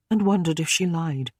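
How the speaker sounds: ordinary delivery, nothing marked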